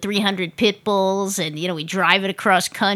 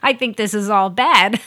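high laughing alarm; giggling mock alarm; laughing protest; 4 semitones higher, 3 dB louder than usual. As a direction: laugh through the panic. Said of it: "I'm like".

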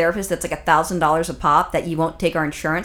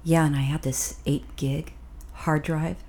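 low, soft, slow sultry demo; sexy voice; 5 semitones lower, 7 dB quieter, slower than usual. Shown as a demonstration. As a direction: purr it low and hushed, like a mock seduction. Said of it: "do it in a sexy voice".

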